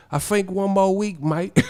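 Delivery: high put-on British accent; British "fink" and "mate", hammed up; mock British fan. British fan repeating the promise, mock accent. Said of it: "the British teeth be like".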